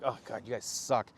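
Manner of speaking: low, soft disgusted aside; retold quiet exasperation; annoyed coach. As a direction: mutter it under the breath, fed up. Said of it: "how, like, annoyed I was".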